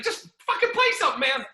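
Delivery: fast, very high retold pleading; exasperated plea. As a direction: rush it out in desperation, pitch way up. Comic urges confused DJ again.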